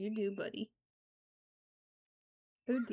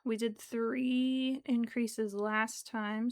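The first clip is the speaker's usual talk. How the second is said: ordinary talk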